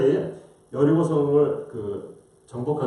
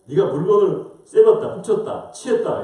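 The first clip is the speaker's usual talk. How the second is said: imitated angry charges, opening loud and high; angry God; 5 semitones higher, 5 dB louder than usual. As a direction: list the offences in mock divine anger. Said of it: "하나님이 화가 나서". (화내며 죄목을 따지는 흉내)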